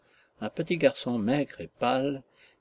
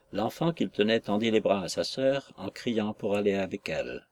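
ordinary delivery, nothing marked

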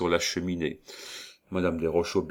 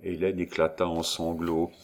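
ordinary delivery, nothing marked

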